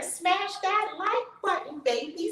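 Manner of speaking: sudden high, sing-song push to like the video; playful hype. Real, not acted. Host teasing viewers to smash the like button, playful high hype.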